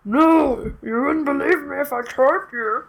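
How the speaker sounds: Cookie Monster style gravel voice; playful monster growl. Pretend. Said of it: "My voice went into a weird combination of, like, man, Cookie Monster".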